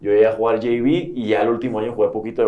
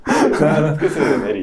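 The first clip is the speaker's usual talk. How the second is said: dicho riendo, más agudo y fuerte; repetición entre risas; 6 semitones higher, 6 dB louder, slower than usual. Retold or imitated. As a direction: suelta la frase muerta de risa. Repite el chiste entre risas. (repite la broma de su amigo riéndose a carcajadas)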